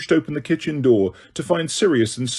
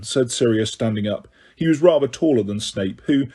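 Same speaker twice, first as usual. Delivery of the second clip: ordinary delivery, nothing marked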